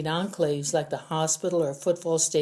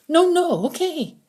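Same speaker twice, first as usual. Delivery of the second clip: higher, louder panicked prisoner; frightened pleading; 13 semitones higher, 7 dB louder than usual. A narrator's reading. Prisoner begging Wilcox not to shoot him, panicked and higher. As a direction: beg for your life in a rush.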